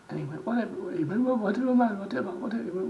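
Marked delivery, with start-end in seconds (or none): 0.5-0.9 dismissive retort in an imitated Pakistani accent; higher-pitched mock Pakistani accent, brushing it off. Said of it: "in his Pakistani accent". 1.2-2.6 loud, high imitated Pakistani accent; repeated brush-off in a mock Pakistani accent. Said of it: "in his Pakistani accent".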